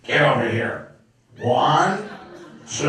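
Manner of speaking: stern mom counting to punish; lightly voiced stern mother. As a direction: count it out like an angry Brooklyn mother. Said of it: "It was like".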